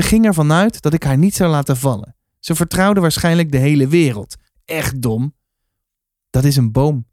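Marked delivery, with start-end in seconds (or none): none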